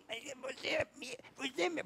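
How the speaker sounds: high-pitched mock wail of a child; high, whimpering child voice; imitated crying child. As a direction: mimic a small boy wailing in protest.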